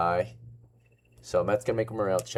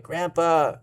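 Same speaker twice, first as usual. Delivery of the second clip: put-on kids screaming; 8 semitones higher, 4 dB louder than usual. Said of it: "the kids scream".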